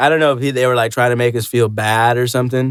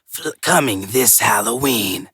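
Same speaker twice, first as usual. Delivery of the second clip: slow, lower movie trailer announcer; mock movie trailer narrator; 3 semitones lower, much slower than usual. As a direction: drag it out like a cheesy trailer. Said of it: "they're like".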